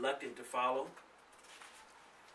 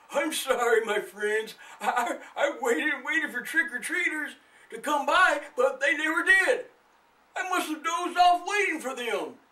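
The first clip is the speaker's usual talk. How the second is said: put-on high, loud sleepy old man; an old man just woken, booming; 12 semitones higher, 6 dB louder than usual. The old man greeting the boy after dozing, high and loud.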